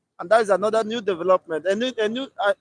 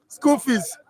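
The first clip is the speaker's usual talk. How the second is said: ordinary talk, nothing marked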